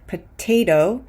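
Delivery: "potato" said like "puh-TAY-doh"; crisp first T, tapped D for the second in "potato"; T then D. Shown as a demonstration. Teacher models "potato" with stressed T, then a D. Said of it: "in the unstressed syllable is a D".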